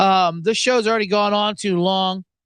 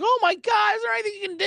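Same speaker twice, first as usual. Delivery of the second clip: 1 mock panicked shout; faster than usual. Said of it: "Hollywood's like".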